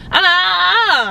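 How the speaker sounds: string of high "ah"s in mock pain; pained yelps. Patient stopping doctor touching her ankle, pained high yelps.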